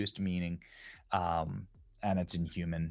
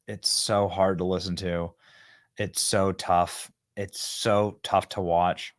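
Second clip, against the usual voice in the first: normal talking voice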